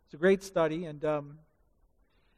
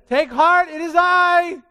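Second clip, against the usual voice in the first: loud, higher reassuring call; 10 semitones higher, 10 dB louder, slower than usual. Acted. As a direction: call across the waves to calm them. Jesus calls to frightened disciples.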